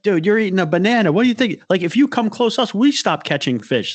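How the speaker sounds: high-pitched mock outrage; jokingly going off. Friend going off on a banana-eating angler, mock-indignant. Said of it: "like, jokingly".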